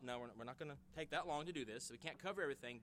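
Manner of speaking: ordinary delivery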